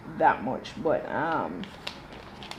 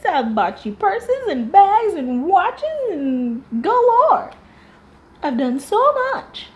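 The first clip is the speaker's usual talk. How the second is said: high, slow, indignant mimicry; boastful mock; 10 semitones higher, 4 dB louder, slower than usual. Mimicking the wife boasting of her gifts, high whiny. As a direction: list each gift in a rising high whine. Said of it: "she starts boasting about".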